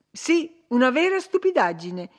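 high, loud scornful retort; piqued and dismissive; irritated retort. A narrator's reading. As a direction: snap back, pitched well up. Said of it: "ribatté Catherine con irritazione".

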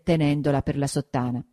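usual speaking voice

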